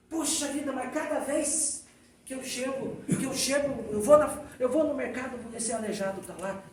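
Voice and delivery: high, irritated griping; nervous complaint. Retold or imitated. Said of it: "meio nervosa".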